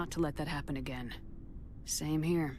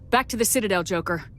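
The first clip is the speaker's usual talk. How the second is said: loud command; 3 semitones higher, 8 dB louder than usual. Acted. Commander orders ship to Citadel.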